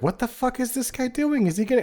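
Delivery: high-pitched baffled squeal of words; mock bewilderment. Onlookers watching the odd gunfighter, high mock bewilderment.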